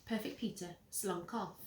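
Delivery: ordinary delivery, nothing marked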